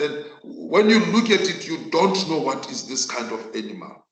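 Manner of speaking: ordinary talk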